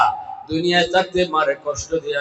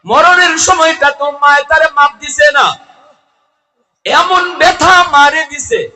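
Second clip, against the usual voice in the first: high, loud chanting sermon delivery; ringing, high-pitched lament to a crowd; impassioned preaching; 11 semitones higher, 9 dB louder than usual. Real, not acted. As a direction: preach at full force, pitched far up. Preaches at full force.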